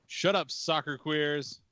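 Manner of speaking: heckling yell. Retold or imitated. Drinker on the porch to soccer players, heckling. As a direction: call it out slowly like a porch heckler.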